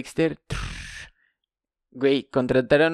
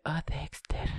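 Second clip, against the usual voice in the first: voz seductora; 2 semitones higher, 12 dB quieter, slower than usual. Retold or imitated. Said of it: "le dice".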